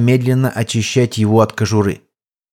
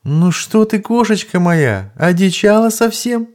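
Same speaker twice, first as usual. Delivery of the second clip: teasing purr; 8 semitones higher than usual. Acted. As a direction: coax like a cat owner.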